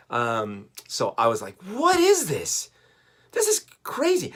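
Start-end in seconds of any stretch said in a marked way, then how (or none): 1.6-4.3 put-on very high, slow childlike wonder; stretched-out, high-pitched kid astonishment; amazed kid. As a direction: squeal it like a thrilled eight-year-old. Child self amazed at toy.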